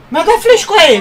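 angry complaint. Real, not acted. Complaining again about flash.

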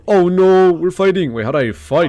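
loud, high panicked outburst; panicked yelp. Real, not acted. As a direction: panic as the fight starts. Attacked by enemy in game.

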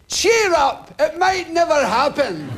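high-pitched mock cheer-up call; imitated high-voiced busybody; chirpy stranger. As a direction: butt in like an irritating passer-by. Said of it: "somebody will come along and say".

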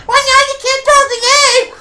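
high, goofy mock voice of a clueless developer; mock whiny developer voice; mocking game developer. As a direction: sneer it like a dumb dev.

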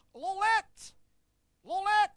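loud, high, drawn-out call. Acted. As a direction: call for someone out of sight. Knight calls out for Lolet.

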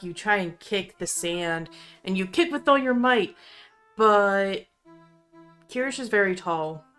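usual speaking voice

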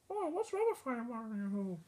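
soft little puppet-ghost voice; ghost character. Acted. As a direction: ask very softly as a little ghost.

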